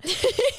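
sudden high-pitched laugh; laughing at her own story. Real, not acted. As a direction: burst into a squeaky laugh. Laughs retelling dad's reaction.

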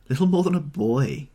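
high, loud mocking sneer on Little. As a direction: throw his words back with scorn. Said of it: "she sneered".

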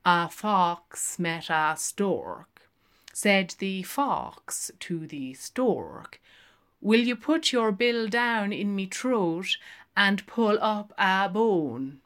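slow sing-song rote reading; flat droning recital. Acted. Lenehan reading a fable to the barmaid, droning. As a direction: drone a children's fable by rote. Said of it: "plappering flatly".

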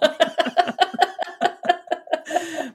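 high, rolling giggle; real laughter that keeps going; long laugh. Real, not acted. Friends enjoy the Atlas Eddie joke, long giggle.

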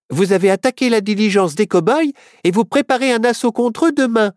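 put-on high young girl's voice; Myrtille, girl voice. A narrator's reading. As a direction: raise the pitch for the girl. Myrtille's quoted line.

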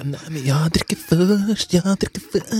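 put-on high, slow, teasing little-boy singsong; teasing child. Son taunting his little sister, high and slow. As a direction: drag it out to tease his sister.

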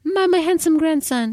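high, fond grandma voice; doting grandma. Acted. Film grandma gushing to her grandson, doting.

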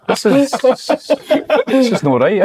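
alarmed inner thought. Retold or imitated. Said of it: "I'm going".

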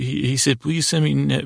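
normal talking voice

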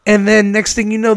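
usual speaking voice

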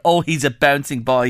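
ordinary talk, nothing marked

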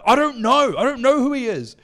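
high, loud defensive protest, acted out; reenacted frantic disowning; panicked denial. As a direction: plead ignorance at the top of your voice. Retells panicked answer to angry aunt.

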